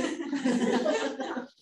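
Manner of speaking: short high giggle. Retold or imitated. Pupil laughing at her classmate, high giggle. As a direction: let a light laugh break out. Child laughs in the quoted exchange.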